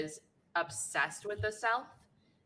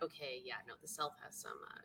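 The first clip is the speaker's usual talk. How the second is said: much softer, lower, uneasy; quiet awkward realization; 5 semitones lower, 15 dB quieter than usual. Retold or imitated. Adult rethinking the South's history, hushed and uneasy. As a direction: drop the voice as it dawns on you. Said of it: "I'm like".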